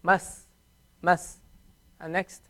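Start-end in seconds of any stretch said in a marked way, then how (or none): 0.0-1.4 "Mas" closed on the s, no vowel after; "Mas" with a held closing s; sukoon on seen. Shown as a demonstration. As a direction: clip "Mas" off on the s. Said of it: "How do you pronounce this?".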